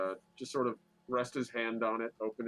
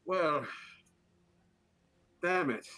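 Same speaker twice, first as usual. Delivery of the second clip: mild annoyed outburst; annoyed curse; 6 semitones higher, slower than usual. Acted. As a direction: curse the ruined shirt. Character upset shirt ruined.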